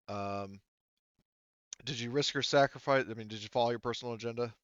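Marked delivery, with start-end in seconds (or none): none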